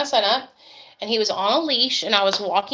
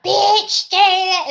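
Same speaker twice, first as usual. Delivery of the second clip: mock yell; 9 semitones higher, 5 dB louder than usual. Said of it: "she's like".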